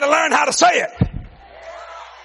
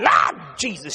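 ordinary talk

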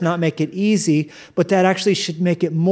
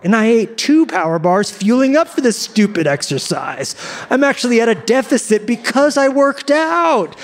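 comic rant rising in pitch; mock indignation; 5 semitones higher than usual. Gym-goer griping about calories burned, mock indignant. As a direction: build to a high-pitched protest of outrage.

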